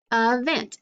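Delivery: "Event" said as uh-VENT; schwa. Shown as a demonstration. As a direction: start "Event" with a short uh. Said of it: "that's how we say it".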